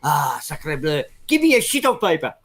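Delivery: hammed-up French accent. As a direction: imitate an exasperated Frenchman. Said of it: "a Frenchman, Thierry".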